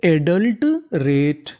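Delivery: very slowly sound out adult रेट; slow demo reading. Shown as a demonstration. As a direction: stretch adult रेट out, each syllable clear. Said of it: "तो meaning बनी हमारी".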